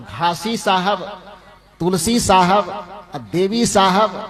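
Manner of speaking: each saint's name given slowly; measured list of saints; slow litany. Acted. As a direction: roll the names out one by one. Preacher listing the saints' names.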